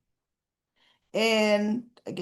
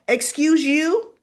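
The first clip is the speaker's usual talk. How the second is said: louder, higher excuse you with attitude; sassy correction; 6 semitones higher, 6 dB louder, slower than usual. Shown as a demonstration. Viewer correcting Leslie, sassy and loud. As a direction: snap back with a put-down. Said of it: "And actually, no".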